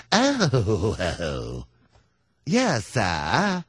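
put-on voice sliding down on a long, impressed "oh"; fawning, awestruck restaurateur; overawed London host. London host accommodating the senator, mock awe.

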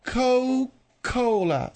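"Coca-Cola" drawn out slowly as Co... Cola; dropped syllable. Shown as a demonstration. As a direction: stretch "Coca-Cola" into Co, pause, Cola. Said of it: "It's called Coke because".

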